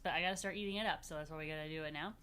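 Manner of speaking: plain reading voice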